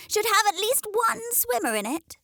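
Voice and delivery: high, prim hen voice; proud high-voiced hen declares; hen declaring. A narrator's reading. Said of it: "she declared".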